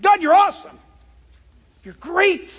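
high, loud burst of awe; re-enacted outburst of worship; exclaimed praise. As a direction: call it up to God, amazed.

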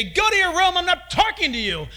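put-on a father sending a kid off; mock angry parent; stern parent. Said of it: "You say".

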